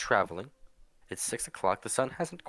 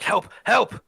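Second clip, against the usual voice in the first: high, loud, frantic yells for help; desperate cry for help; 12 semitones higher, 9 dB louder than usual. Acted. Wounded stranger calls to the party for help, frantic loud cries. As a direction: shout it like a wounded man flagging down the wagon. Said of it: "he waves his hands up and arms up and down, like".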